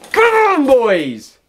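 high loud cry of come on; shout. Real, not acted. Gamer urging a pack, loud high shout. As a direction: yell at the pack to deliver.

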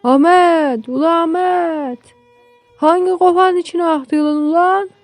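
put-on high, stretched holler to the boy; calling out. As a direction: shout across the field for an answer. Father calls boy from afar.

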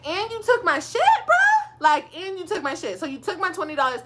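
loud, high, disbelieving accusation; indignant retold outburst. Confronting the ex about taking her things. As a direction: squeal it in outrage. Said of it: "And I'm just like".

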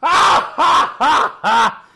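very high, loud villain cackle; loud gleeful monster laugh; very high, loud cackle as Krampus; evil cackle. Acted. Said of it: "starts cackling".

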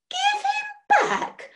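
very high, slow, drawn-out squeak; the voice shoots up in horror; full outraged squeak. Acted. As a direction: witch at the top of an outraged squeak. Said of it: "Her voice had risen to an outraged squeak".

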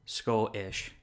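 Scottish in two parts, no T between; swallowed double T. Shown as a demonstration. Repeating the lesson, Scottish with T's skipped. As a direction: break Scottish where the T's would be. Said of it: "just skip your double T's".

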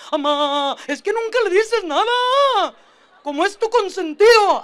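put-on high, loud kid voice with a laugh, complaining; a kid protesting to mom that she favors his brother; whiny complaining kid. Niño se queja con la mamá. (niño agudo quejándose con la mamá, entre risas)

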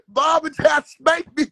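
ordinary talk

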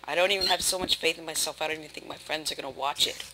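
ordinary talk, nothing marked

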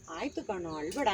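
very soft, low reassurance; relaxed, soothing. Shown as a demonstration. Director shows the actor how to console, relaxed and soft. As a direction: hush it right down and comfort gently. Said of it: "ಆರಾಮಾಗಿ ತಗೋ ಪುಟ".

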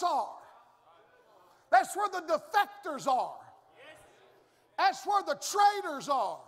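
shouted, high preaching build; fiery preaching. Acted. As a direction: hammer it home from the pulpit. Preaching at full force.

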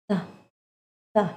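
tongue to front teeth, breathe out "the"; airy TH sound. Shown as a demonstration. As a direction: demonstrate "the" as a short puff of air over the tongue. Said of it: "ลองเอามือป้องที่ปากแล้วออกเสียงดู". (ออกเสียง the ให้มีลมออกมา)

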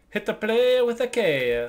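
high, slow exaggerated Italian accent. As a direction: stretch it out in a big Italian accent. Repeats Italian phrase louder.

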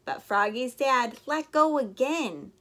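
plain reading voice

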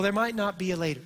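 ordinary talk

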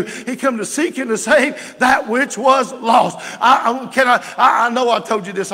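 loud, high-pitched impassioned sermon; shouted revival-style preaching, rising in intensity; full-force preaching. Real, not acted.

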